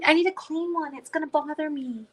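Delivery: high, whining complaint; whiny voice. Real, not acted. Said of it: "that was a whiny voice".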